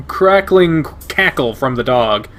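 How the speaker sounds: usual speaking voice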